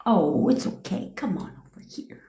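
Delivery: soft, unvoiced comforting murmur; mock consoling whisper. Partner soothing someone who cries, soft mock whisper. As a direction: hush them like a partner consoling a crier. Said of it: "what are things that people do".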